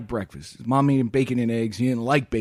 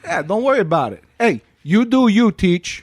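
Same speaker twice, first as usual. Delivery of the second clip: put-on louder, higher New York wiseguy accent; 4 semitones higher, 6 dB louder than usual. Boy brushes off teacher in a brash New York accent. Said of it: "say that in the accent too".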